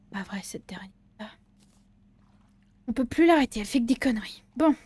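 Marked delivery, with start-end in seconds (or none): 0.1-1.3 soft unvoiced whisper. Real, not acted. Grumbling about the ghost in a whisper. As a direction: whisper it aside.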